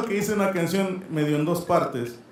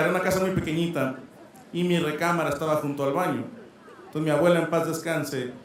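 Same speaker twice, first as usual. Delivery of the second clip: ordinary talk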